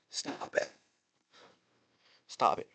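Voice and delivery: whispered plea. Real, not acted. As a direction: breathe it out as a whisper. Repeating plea to WWE.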